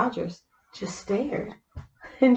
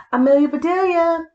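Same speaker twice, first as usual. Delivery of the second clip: loud, high call across the house; yelling; 10 semitones higher, 7 dB louder than usual. Acted. Mister Rogers yells for Amelia Bedelia, loud call. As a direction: yell her name to find her. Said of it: "yelled Mister Rogers".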